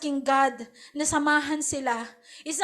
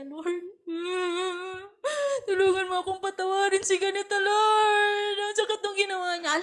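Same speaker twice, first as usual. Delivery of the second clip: higher, pleading prayer voice; pained prayer; 5 semitones higher than usual. Retold or imitated. Disciple praying to forgive someone, pained higher plea. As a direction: plead to the Lord, hurt.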